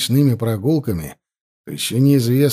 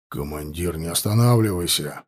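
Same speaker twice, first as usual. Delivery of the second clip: low, quiet growl; growled warning; 7 semitones lower, 4 dB quieter than usual. A narrator's reading. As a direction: growl the warning under your breath. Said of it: "прорычал Кустовой".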